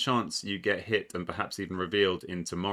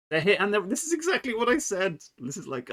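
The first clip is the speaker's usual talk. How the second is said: high, frustrated I-told-you-so cry; emotional outburst; 13 semitones higher than usual. Real, not acted. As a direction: let the frustration burst out high. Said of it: "I'm getting emotional here".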